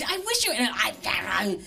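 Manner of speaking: high-pitched spluttering outburst; mock squabbling. Partner fighting over bad driving, spluttering in mock anger. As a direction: start high and trip over the words. Acted couple's fight in the car.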